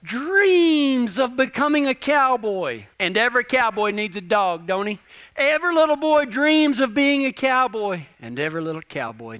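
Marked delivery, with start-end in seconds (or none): 0.0-2.9 preaching refrain. Acted. Preaching key line loudly. 5.4-8.0 preaching refrain. Acted.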